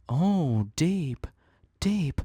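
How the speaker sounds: high, loud teasing awe; mock awed. Real, not acted. Vlogger mocking own philosophy, high teasing awe. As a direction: tease your own profound line. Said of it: "mocking own deep line".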